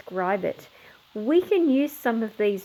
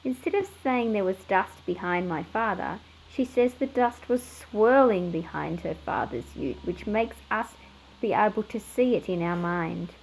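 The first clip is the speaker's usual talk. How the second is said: usual speaking voice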